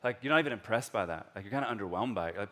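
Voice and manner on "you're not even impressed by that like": ordinary talk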